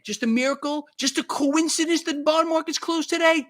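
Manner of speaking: high-pitched sarcastic questioning; mock wonder. Blogger doubting the bond market closure, sarcastic. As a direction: feign innocent amazement, voice lifted high. Rhetorical jab at market timing.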